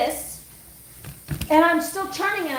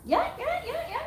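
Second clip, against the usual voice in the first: very high imitated yelping; quick, high-pitched dog yelps; scared little dog; 3 dB quieter than usual. Little Shih Tzu running in the park, scared high yelps. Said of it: "like, so scared".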